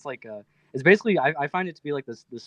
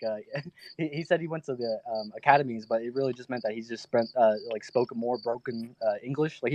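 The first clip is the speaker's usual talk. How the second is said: usual speaking voice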